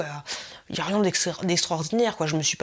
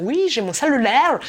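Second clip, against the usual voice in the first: loud, higher mock Québécois; Québécois accent; 6 semitones higher, 7 dB louder than usual. Imitating a dubbed film character suddenly turning Québécois accent. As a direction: burst into a caricatured Québécois twang. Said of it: "en version québécoise".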